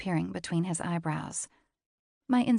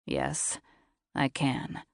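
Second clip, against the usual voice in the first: low firm male voice; 4 semitones lower, slower than usual. A narrator's reading. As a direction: lay down the law, low.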